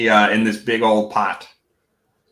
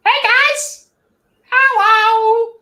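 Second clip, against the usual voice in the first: much higher, louder sing-song goblin greeting; squeaky goblin; 5 dB louder, much slower than usual. Acted. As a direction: chirp hello like a tiny goblin. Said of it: "Wessel turns to you and goes".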